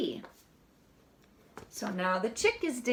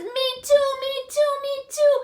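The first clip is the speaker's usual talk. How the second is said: high, loud little chick; a chick copying the duck, high and loud; 10 semitones higher, 6 dB louder than usual. Acted. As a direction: squeak it out eagerly like a tiny chick. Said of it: "He says".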